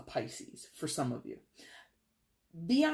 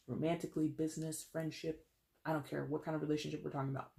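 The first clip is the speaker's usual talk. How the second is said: ordinary delivery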